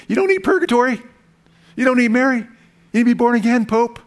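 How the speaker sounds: mock evangelist bellowing at the Pope; preaching at the Pope. Traveler in the joke preaches to the Pope, high and ringing.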